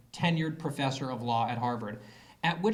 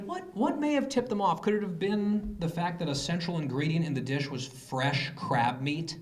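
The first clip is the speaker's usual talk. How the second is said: mock incredulous. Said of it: "And I was like".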